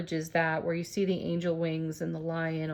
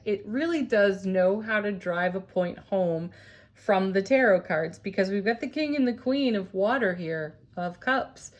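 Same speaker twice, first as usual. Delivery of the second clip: ordinary talk, nothing marked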